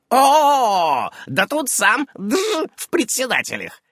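high, animated witch voice; high-pitched, lively old witch. A narrator's reading. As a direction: exclaim over a discovery, then press the question.

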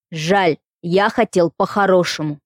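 low, slow hollow threat; through a tube. A narrator's reading. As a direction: growl it as if down a pipe. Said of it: "будто кто-то говорил в трубу".

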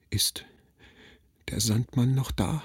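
whispered, trembling question from the sickbed; a frightened child barely daring to ask; small hushed voice asking if the Sandman is still there; stammering child. Acted. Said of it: "stammelte ich".

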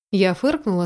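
ordinary delivery

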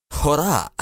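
mild cry of loathing; loathing outcry. A narrator's reading. Enemy officer interrupts Adham, mild contemptuous outcry. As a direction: cut in with understated contempt. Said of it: "انطلقت الصيحة في مقت".

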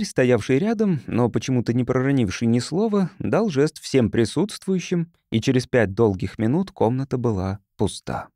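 plain reading voice

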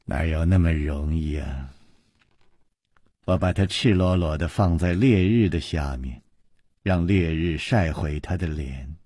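low, soft, menacing villainess; quiet, deliberate malice; cruel villainess. A narrator's reading.

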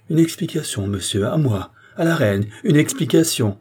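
indignant exclamation. A narrator's reading. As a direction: throw the demand back in outrage. Queen indignant at being challenged.

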